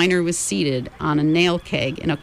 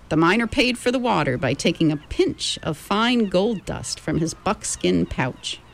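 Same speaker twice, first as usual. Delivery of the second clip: usual speaking voice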